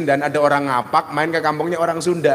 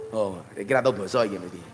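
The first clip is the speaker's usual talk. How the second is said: ordinary talk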